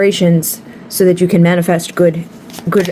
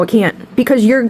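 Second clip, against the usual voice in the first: ordinary talk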